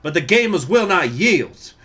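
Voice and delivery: hammed-up Alabama accent. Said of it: "I need to say it with the Alabama accent".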